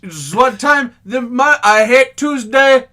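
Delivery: slow, stilted bad South African accent; hammy cartoon South African accent; botched South African accent. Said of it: "Why is he South African".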